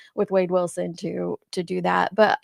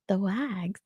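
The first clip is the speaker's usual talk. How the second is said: breathy whispered echo; whispering; 9 dB quieter than usual. Real, not acted. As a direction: whisper it to yourself, puzzled. Puzzled echo of chat term.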